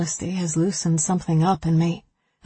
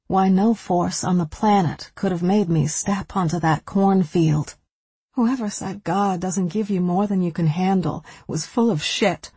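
ordinary delivery, nothing marked

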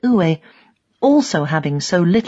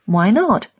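ordinary delivery, nothing marked

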